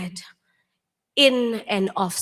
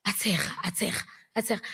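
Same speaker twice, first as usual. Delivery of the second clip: hushed aside; 2 semitones lower, 7 dB quieter, much faster than usual. Retold or imitated. Telling how he laughed.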